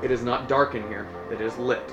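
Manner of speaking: normal talking voice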